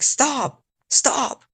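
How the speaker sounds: high-pitched pleading little sister; little girl voice. Acted. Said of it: "a little girl accent".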